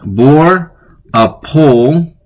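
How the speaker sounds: slow, drawn-out bore a pole; trying out a spoonerism slowly. Shown as a demonstration. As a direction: sound out bore a pole carefully. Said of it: "these are becoming tongue twisters".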